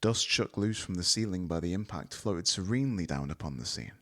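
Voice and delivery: ordinary delivery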